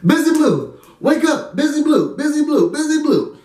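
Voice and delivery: high-pitched, insistent name-calling; urgent calling out. Retold or imitated. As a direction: imitate friends trying to rouse someone. Friends trying to wake drunk teller.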